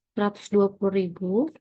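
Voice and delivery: ordinary talk, nothing marked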